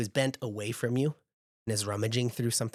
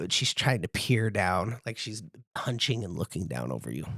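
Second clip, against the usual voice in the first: usual speaking voice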